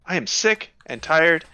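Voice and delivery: slow, emphatic anger; acted intimidation, slowly building; fed-up threat. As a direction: weigh each word in exasperation.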